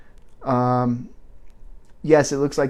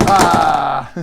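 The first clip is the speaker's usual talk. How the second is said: excited yelp; 4 semitones higher, 9 dB louder than usual. Real, not acted. Delighted at the camera angle.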